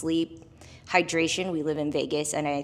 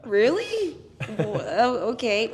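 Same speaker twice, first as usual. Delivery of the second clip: high, laughing fake astonishment; mock surprise; 6 semitones higher, 3 dB louder than usual. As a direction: act shocked, half-laughing. Retelling people's shocked reaction.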